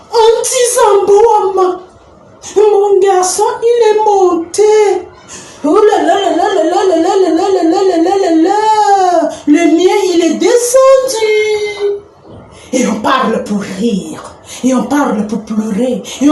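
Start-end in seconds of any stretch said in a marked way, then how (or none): 0.1-5.1 high, slow, drawn-out brag; boasting mother. Acted. Women bragging about their children. 5.7-9.3 high, wavering string of "là là"; exclaiming mother. Acted. Mother reacts to another's boast. 9.5-12.0 high, slow, stretched-out retort; rival mother. Acted. Rival mother answers the boast.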